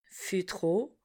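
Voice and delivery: Fût trop repeated very softly, trop stressed, rising. Shown as a demonstration. Teacher echoes Fût trop quietly for the class. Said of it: "passons au son O".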